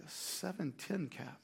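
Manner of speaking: very quiet, low mock-baffled repeat; puzzled echo. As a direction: echo it under your breath, not understanding. Baffled clerk echoes customer's request.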